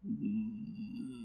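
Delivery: low, soft grunting hum; a low goblin grunt; low goblin grunt, mouth closed. Retold or imitated. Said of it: "That's the sound he made".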